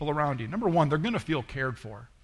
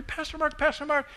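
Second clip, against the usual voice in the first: a much higher, eager call of his name; excited call; 12 semitones higher than usual. Retold or imitated. Woman running up to her pastor, excited high call. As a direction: call out to him thrilled. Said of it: "She was so bright and excited".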